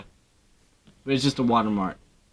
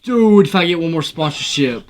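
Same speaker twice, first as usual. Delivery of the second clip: exasperated outburst; 3 semitones higher, 5 dB louder, slower than usual. Real, not acted. Fed up with sponsorship pop-ups on the video, exasperated. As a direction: cry out in exasperation.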